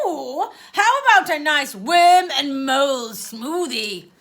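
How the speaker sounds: aunt coaxing her niece; coaxing offer. Acted. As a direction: dangle the treat.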